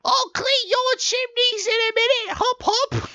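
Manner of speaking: sing-song like a cheeky cartoon sweep; high squeaky randy chimney sweep. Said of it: "a very Randy chimney sweep".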